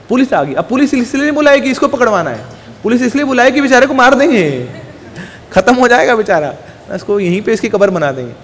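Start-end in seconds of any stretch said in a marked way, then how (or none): none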